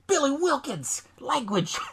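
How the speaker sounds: high, slow reprimand; mock parental telling-off; scolding father. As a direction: chide a child by full name. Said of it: "he's yelling at his kid".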